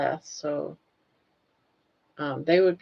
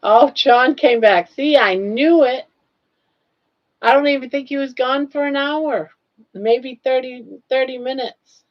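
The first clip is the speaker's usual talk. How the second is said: excited exclaim; 5 semitones higher, 7 dB louder than usual. Real, not acted. Surprised John returned so soon.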